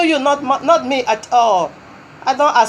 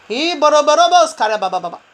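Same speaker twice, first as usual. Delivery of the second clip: speaking in tongues; 5 semitones higher, faster than usual. Real, not acted.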